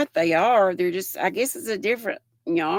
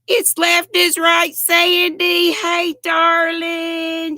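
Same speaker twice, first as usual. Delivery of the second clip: excited greeting; 10 semitones higher, 7 dB louder, slower than usual. Real, not acted. Greeting live chat viewer.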